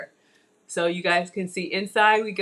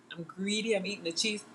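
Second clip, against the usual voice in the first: usual speaking voice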